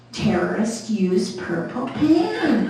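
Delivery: ordinary talk, nothing marked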